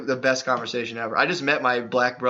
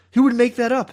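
pitched way up and louder in disbelief; incredulous outburst; 10 semitones higher, 5 dB louder than usual. Real, not acted. Defending a friend's claim, incredulous high outburst. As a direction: insist nobody would invent that.

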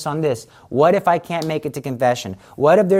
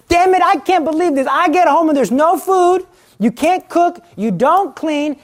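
high, loud ranting at the wife; husband berating his wife, high and loud; angry husband; 11 semitones higher, 4 dB louder than usual. Acted. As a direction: rant like a fed-up husband.

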